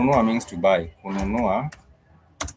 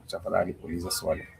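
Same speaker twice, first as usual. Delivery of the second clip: soft quick repeat; 8 dB quieter, faster than usual. Shown as a demonstration. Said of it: "In case you do have a question".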